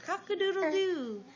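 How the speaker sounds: high crowing cock-a-doodle-doo; rooster crow. Shown as a demonstration. Reading to a child, crowing like a rooster. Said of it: "He can sound like a rooster".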